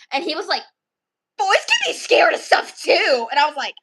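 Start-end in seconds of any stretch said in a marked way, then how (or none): 1.4-3.3 slow, loud, high mock protest; indignant boy. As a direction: imitate a boy defending himself. Retells boy's indignant comeback.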